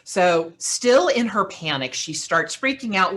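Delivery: ordinary delivery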